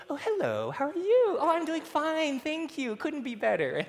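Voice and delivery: high, sugary "oh, hello" on the phone; family answering church call mid-argument, high and sugary; fake polite phone voice. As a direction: put on sweetness for the church caller. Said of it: "and everyone".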